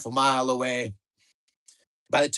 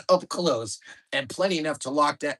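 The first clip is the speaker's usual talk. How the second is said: ordinary delivery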